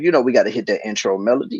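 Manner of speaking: normal talking voice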